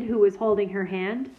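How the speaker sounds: ordinary delivery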